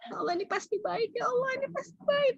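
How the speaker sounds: acted tearful affirmation, pitched high; sambil menangis. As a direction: affirm it through put-on sobs, voice climbing. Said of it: "Nangis, Bu, nggak apa-apa". (ikrar sambil pura-pura menangis, nada tinggi)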